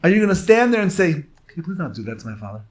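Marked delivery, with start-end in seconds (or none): 1.1-2.7 softer, quick, timid little request; mocking a son who won't defend his father; meek polite plea. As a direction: ask politely like a pushover. Timid response mocked.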